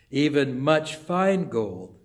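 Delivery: normal talking voice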